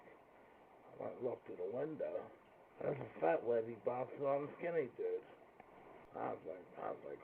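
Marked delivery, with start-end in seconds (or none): none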